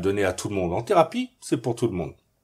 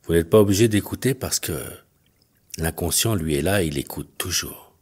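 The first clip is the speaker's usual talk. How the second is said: low, measured hypnotist's voice with long pauses; hypnosis induction; 7 semitones lower than usual. Acted. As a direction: guide them gently into trance, leaving space between phrases. Leads a hypnosis session.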